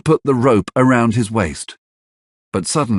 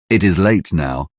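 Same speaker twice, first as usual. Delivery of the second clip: plain reading voice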